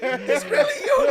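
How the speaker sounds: high, loud disbelieving squeal; laughing in mock disbelief; incredulous laughing. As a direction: shriek it out through laughter. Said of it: "like, he, like".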